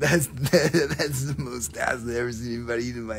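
lightly voiced laid-back stoner; Tommy Chong. Seller recalls Up in Smoke, a lightly voiced laid-back stoner. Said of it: "Tommy Chong, man".

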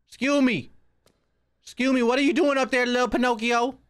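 high-voiced calling out. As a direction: call up in a high put-on voice. Calling up to a game figure.

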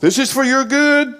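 high, loud shrill-free scolding mother voice; mother's reply. Retold or imitated. Preacher voicing mother or aunt washing his eyes, high and loud. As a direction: play the aunt firmly insisting it helps.

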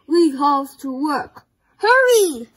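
loud urgent rallying shout; calling out. A narrator's reading. Santa calling his friends to work, loud urgent call. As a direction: hurry them along at full volume.

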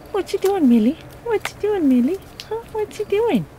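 high cooing voice for a pet; talking to a dog. Real, not acted. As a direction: pitch it up and coo at the dog. Owner coos at dog Millie.

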